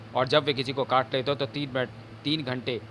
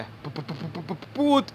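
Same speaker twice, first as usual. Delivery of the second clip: stuttering in fear; terrified stammer; 3 semitones higher, slower than usual. Acted. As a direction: choke out the word in terror. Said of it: "बड़ी कठिनाई से जुबां पर". (डर से हकलाते हुए)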